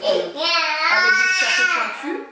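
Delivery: high, slow, mock-eerie croak on the hats; spooky witch voice. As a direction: put on a spooky little witch tone. (voix de sorcière pour rire, aiguë et lente)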